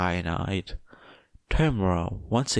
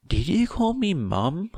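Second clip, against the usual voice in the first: much higher startled question; surprised mum; 11 semitones higher, 3 dB louder than usual. Acted. Mother startled at being called Mum. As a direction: catch her off guard, high.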